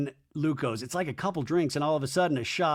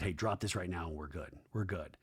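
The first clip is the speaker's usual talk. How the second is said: low and much softer, imitated; discreet aside; 9 semitones lower, 11 dB quieter than usual. Luke tells Danny to drop it, low retold aside. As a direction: drop the voice to settle him down privately.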